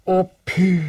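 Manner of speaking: high, loud joyful-length cry; calling out. Acted. Boy calls out for his missing bear, calling out. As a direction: call out to a friend, high and loud. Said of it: "ropade Kristoffer Robin".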